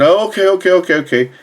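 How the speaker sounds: imitating himself panicking; a retold flustered patient agreeing; flustered okays. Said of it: "I'm freaking out".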